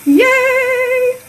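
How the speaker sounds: high, loud cheer of delight; gleeful high yay. Real, not acted. Cheering gender reveal party idea.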